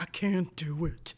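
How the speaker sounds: slow, hammed-up refusal; mock character voice, slowly overdone; put-on character voice. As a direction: mimic the refusal, drawing it out. Repeated quote in put-on voice.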